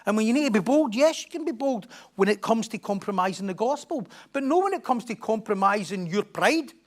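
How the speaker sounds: loud, high, driving preacher's climax; preaching at full force. Real, not acted. Pastor urging the church on, preaching at full force. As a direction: drive it home to the congregation.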